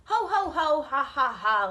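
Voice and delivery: loud jeering "ho, ho, ho, ha, ha, ha"; loud mock laugh. Two lazy pigs jeering at their brother, loud laughter. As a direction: laugh it up at him. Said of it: "laughed the two lazy little pigs".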